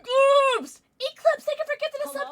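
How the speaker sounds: high, loud, fast gushing; excited thanks. Real, not acted. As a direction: gush out the thanks. Thanking a gift sub.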